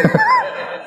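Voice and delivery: short high-pitched laugh. Real, not acted. Preacher joking that there would be no study circle, laughing. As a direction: break into an amused laugh. Amused at his own aside.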